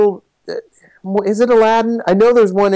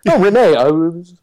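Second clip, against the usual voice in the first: over-the-top Rene; 4 semitones higher than usual. Shown as a demonstration. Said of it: "I just get real quiet as I say it".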